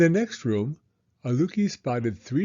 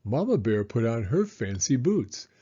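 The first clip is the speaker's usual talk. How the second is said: usual speaking voice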